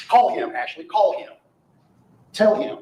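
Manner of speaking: acted insistent command. Lawyer re-enacts orders given to Ashley, insistent calling. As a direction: press harder on each repeated command.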